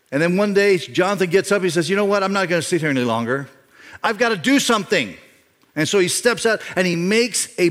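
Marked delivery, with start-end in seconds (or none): 1.9-5.2 resolute. Acted. Voicing Jonathan's resolve to act.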